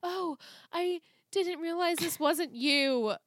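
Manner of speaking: high-pitched fake-innocent excuse; imitating a feeble excuse, high and sing-song; mock innocence. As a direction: play dumb in a high, put-on voice. Mocking a character's feeble excuse.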